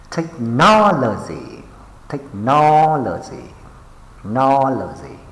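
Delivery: technology with a long, punched NOL; tech-NOL-ogy, leaning hard on NOL; stress on second syllable. Shown as a demonstration. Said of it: "pay attention to the stress syllables".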